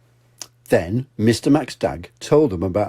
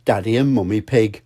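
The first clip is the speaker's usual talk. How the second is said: ordinary delivery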